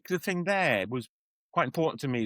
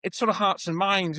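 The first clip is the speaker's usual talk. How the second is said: ordinary delivery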